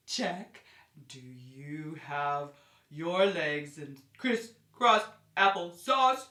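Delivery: ordinary talk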